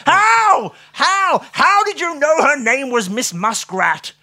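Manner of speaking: high, loud, overwrought astonishment; joyful amazement. A narrator's reading. Watson begs Holmes to explain, hysterically amazed. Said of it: "crying tears of joy and amazement".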